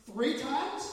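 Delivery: loud, high, disbelieving question; incredulous. Retold or imitated. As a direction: throw it back at him in disbelief. Shocked at Bill's spending.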